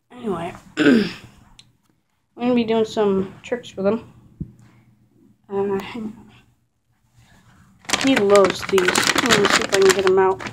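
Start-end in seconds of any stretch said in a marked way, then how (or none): 5.5-6.3 breathy aside to herself; hushed whisper while fumbling. Real, not acted. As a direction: drop to a whisper.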